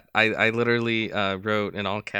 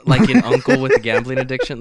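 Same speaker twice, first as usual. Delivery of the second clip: laughing; 2 semitones higher, 8 dB louder, faster than usual. Real, not acted. Joking about villain's vibe.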